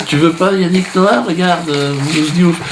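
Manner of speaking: high, wheedling fake-sweet offer; high mock fairy. Fairy tries to sell the boy another celebrity, high and wheedling. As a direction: coax him up high like a pushy fairy.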